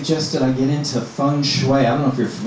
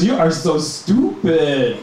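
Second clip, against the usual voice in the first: slow, higher, drawn-out put-down; mock scorn; 4 semitones higher, much slower than usual. Friend putting down the joker, slow mock scorn. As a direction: stretch each word in comic disgust. Jose acted, scoffing at friend.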